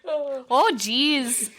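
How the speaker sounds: lightly put-on Minnesota accent; mock Minnesota lilt. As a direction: do a bit of Minnesotan. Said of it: "talk like that in Minnesota".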